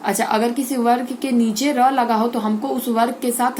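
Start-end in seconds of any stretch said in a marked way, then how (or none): none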